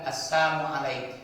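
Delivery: loud, sneering "Assalamu alaik" with assamu slurred in; mocking twisted greeting. Preacher imitates a man mocking the Prophet, loud and sneering. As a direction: spit out "Assalamu alaik" as a jeer. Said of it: "Orang Yahudi itu mengatakan".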